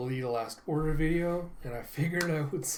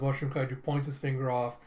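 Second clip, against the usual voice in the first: usual speaking voice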